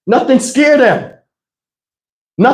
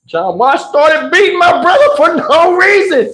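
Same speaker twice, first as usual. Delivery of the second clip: ordinary talk, nothing marked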